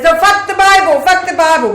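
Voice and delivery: put-on high, loud French-accented defiance. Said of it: "he says in his French accent".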